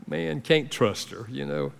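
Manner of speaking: a resentful mutter, kept low and soft; muttered thought. Retold or imitated. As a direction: grumble quietly about the wife. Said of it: "and I thought".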